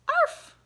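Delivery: very high, loud yappy arf; dog bark. Retold or imitated. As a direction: yap like a little dog. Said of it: "Bill says".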